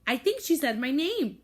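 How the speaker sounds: thrilled she said my name; excited exclaim. Acted. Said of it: "Rose exclaims".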